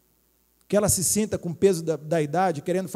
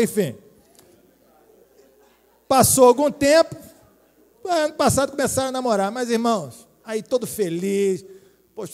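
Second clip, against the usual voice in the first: normal talking voice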